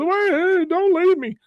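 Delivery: high, whiny plea; imitating a pleading man in a high voice; Bill's voice. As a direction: squeal like a man left behind.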